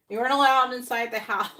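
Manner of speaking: laughing through the words, high and loud. Real, not acted. Repeating the clip's joke to viewers, laughing. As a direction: crack up while repeating it.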